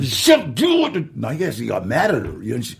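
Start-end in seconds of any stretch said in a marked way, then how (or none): 0.0-0.9 retold mad outburst; angry command. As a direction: order her to just do it. Angry order to director.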